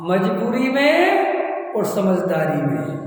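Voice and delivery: two key words spelled out slowly; slow deliberate teaching contrast; slow emphatic. Shown as a demonstration. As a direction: lay each word down slowly for the listeners. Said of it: "दो शब्द बताओ".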